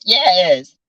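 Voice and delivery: usual speaking voice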